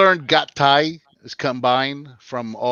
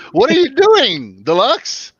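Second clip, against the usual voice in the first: surprised shout; 11 semitones higher, 6 dB louder, slower than usual. Real, not acted. Friend appears on camera.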